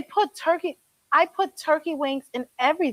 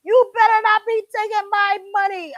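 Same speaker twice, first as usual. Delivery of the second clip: raised, bossy customer voice; loud warning quote; 7 semitones higher, 6 dB louder than usual. Retold or imitated. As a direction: bark the warning like an annoyed customer. Customer's warning, retold louder.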